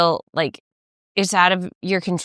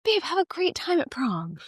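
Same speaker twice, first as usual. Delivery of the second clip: high soft tender mom; sweet send-off; 8 semitones higher, 7 dB quieter than usual. Retold or imitated. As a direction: wish your son well gently. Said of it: "a really sweet moment with him being like".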